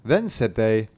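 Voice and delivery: ordinary delivery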